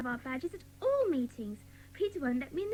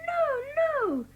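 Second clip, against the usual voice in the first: high, loud, urgent no; loud protest; 10 semitones higher, 7 dB louder than usual. Acted. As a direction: cry out to save the dog. Child protests leaving dog behind.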